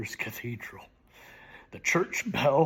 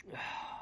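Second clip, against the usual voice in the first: soft sigh; 14 dB quieter than usual. Acted. Dramatic poem recitation.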